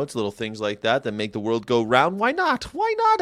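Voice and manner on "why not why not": very high-pitched, playful questioning; squeaky mock-innocent question; playful squeak. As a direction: pitch it way up, almost falsetto. Playful rhetorical cry of why not.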